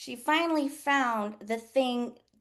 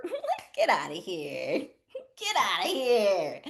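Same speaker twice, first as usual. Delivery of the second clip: slow, laughing get outta here; laughing scoff; 3 semitones lower, slower than usual. Retold or imitated. Scoffing at employers' doubts, laughing slowly. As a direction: laugh it off as absurd.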